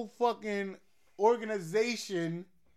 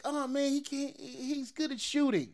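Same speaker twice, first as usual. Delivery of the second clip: higher-pitched imagined doubter; mock naysayer; 5 semitones higher, 4 dB quieter than usual. Fan voicing a doubter of John Wick's skills, mock naysaying. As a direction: play someone underselling the hero, pitched up. Voices a doubter, then answers "Nah.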